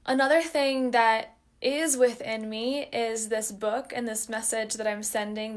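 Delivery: normal talking voice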